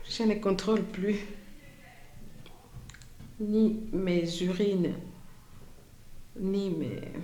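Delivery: softly spoken survivor's testimony, long pauses between phrases; témoignage déclamé. Acted. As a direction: recount it quietly as the woman who lived it. (témoignage dit à voix basse, avec retenue)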